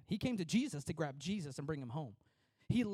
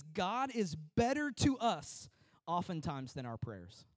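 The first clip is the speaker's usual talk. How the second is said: slow quotation; slower than usual. Shown as a demonstration. Preacher reads John Trapp's words to the church, slow and deliberate. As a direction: quote read slowly, every word weighed. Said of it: "John Trapp says this".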